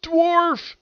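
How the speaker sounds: mock shock, leaping high on the repeat. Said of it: "[name] and [name] are shocked".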